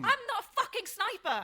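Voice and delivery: high yell with a bitter laugh; furious shout. Acted. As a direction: scoff and shout back. Heated quarrel with the narrator.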